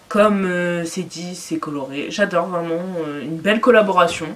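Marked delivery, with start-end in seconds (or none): none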